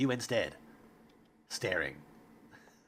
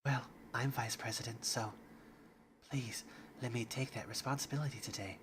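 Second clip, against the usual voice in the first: much softer timid voice; 9 dB quieter than usual. Acted. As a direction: murmur it nervously. Shy Yuri.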